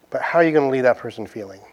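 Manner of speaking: normal talking voice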